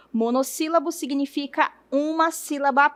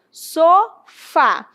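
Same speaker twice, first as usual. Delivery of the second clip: "Sofá" split in two, louder on "fá"; stress on FÁ; 3 semitones higher, 5 dB louder than usual. Shown as a demonstration. Teacher presenting the second word, separated syllables in "Sofá". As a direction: sound out "Sofá" slowly as so, FÁ. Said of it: "Quem é a mais forte?".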